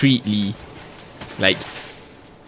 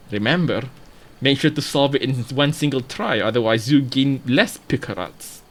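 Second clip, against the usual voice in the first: put-on British accent. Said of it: "butchering every single accent".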